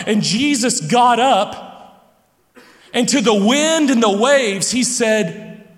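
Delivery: animated full-force preacher; fervent preaching. Real, not acted. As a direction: preach it with rising energy, a little laughing. Preaching the storm story at full force.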